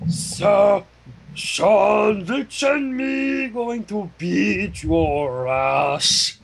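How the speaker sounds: lightly voiced mock menace; mock threat. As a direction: drawl it as a goofy threat.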